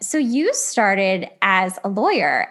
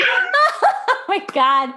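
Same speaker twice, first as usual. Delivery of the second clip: Oh my God squeezed out through real laughter, pitched up and drawn out; laughing disbelief; 10 semitones higher, much slower than usual. Real, not acted. Host cracking up at the guest's UN story, laughing disbelief. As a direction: laugh it out, stunned. Laughing at nobody speaking French.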